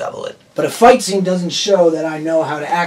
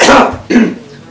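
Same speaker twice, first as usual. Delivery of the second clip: loud sudden cough; 7 semitones higher, 7 dB louder than usual. Real, not acted. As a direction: cough from a cold. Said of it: "I have a cold".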